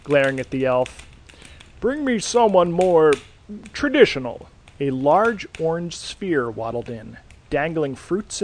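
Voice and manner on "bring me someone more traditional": higher, louder exasperated order; annoyed demand. Acted. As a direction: snap it at the elf. Santa angrily demands the elf.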